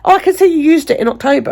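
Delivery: high, quick, chirpy mock receptionist; imitating receptionist. Receptionist lectures patient on the phone, high, quick mock voice. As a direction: rattle it off high like a breezy receptionist.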